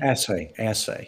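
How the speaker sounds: "assay" starting like "ass"; corrected pronunciation. Shown as a demonstration. Doctor answering the host's question on the word, corrected pronunciation. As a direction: say "assay" as ASS-ay, short flat a. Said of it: "Think ass, assay".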